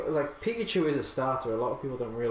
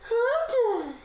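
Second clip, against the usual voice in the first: very high, loud squeaky call; cartoon character voice; 6 dB louder than usual. Player saying his name on request, high cartoon voice. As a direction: sing out the name in a cartoon falsetto. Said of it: "Say R J in that name, in that voice".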